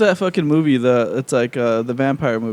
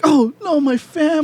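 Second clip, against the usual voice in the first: mock panicked dad; 12 semitones higher, 4 dB louder than usual. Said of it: "he's like".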